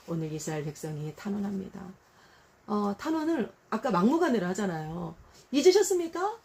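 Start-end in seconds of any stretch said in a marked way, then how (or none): 5.5-6.4 high, loud accusing cry. Retold or imitated. Israel pleading with God, loud and high. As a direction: hurl the question upward. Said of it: "막 이러잖아요".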